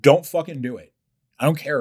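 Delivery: loud, high blast of a warning; shouted warning. Retold or imitated. Warning listeners off the workout, shouted. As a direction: yell it at them to stop.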